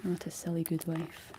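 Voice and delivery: usual speaking voice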